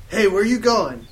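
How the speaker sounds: loud call after someone. Acted. Satan calling to a falling girl, loud. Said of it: "Satan asked as I fell".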